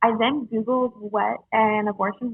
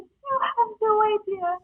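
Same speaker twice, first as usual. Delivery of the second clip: high-pitched imitation of a crying sister; lightly mimicked tearful plea; retold tearful outburst; 8 semitones higher than usual. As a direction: voice her overcome, pitched way up. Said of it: "And she starts crying".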